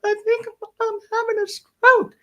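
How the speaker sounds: tiny high strained voice, close to tears; hammed-up hungover whimper, almost crying; barely able to talk. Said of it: "I'm like this, I can barely talk".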